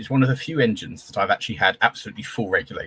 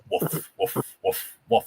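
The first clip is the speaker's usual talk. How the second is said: puffing woof woof like a straining loco; engine chuff imitation; 6 semitones higher, much slower than usual. Describing Marcia on the bank, imitating the engine chuffing. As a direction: mimic the steam engine's chuff. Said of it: "It's just going woof".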